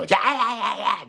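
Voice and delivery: high-pitched excited yelping; Andy. Retold or imitated. Imitating an excitable business partner when excited, high-pitched and loud. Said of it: "really high-pitched voice when he gets excited".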